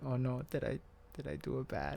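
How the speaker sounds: small worried voice. Retold or imitated. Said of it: "So I'm just like".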